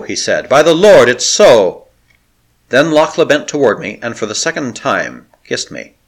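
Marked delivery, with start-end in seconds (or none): 0.5-1.9 hopeful exclamation. Acted. Hope rekindled, reckless grin.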